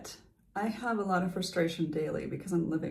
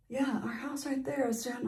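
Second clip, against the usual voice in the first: quoted neighbor; 6 semitones higher, 5 dB quieter than usual. Retold or imitated. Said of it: "she says".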